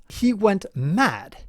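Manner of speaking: He went mad said slowly, word by word; slow emphatic quote. Shown as a demonstration. Host explaining British usage, quoting slowly. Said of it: "the way they use mad is".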